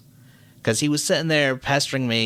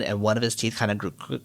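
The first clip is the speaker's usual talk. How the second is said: usual speaking voice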